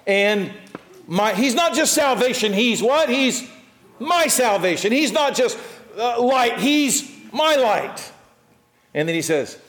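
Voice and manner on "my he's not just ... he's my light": higher and louder, "my" hammered; preaching stress on my. Real, not acted. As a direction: drive home the word my to the congregation. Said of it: "call and response stress on my".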